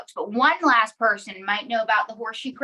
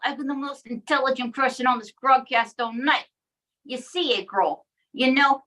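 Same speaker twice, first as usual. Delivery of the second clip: ordinary delivery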